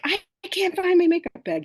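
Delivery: high, loud retold panic; freaking out. Woman retelling her frantic search for a makeup bag, freaking out. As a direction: re-enact a frantic outburst, pitch jumping right up. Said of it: "I'm, I'm freaking out".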